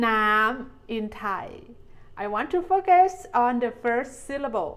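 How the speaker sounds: usual speaking voice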